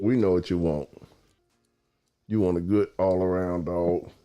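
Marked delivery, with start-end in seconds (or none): none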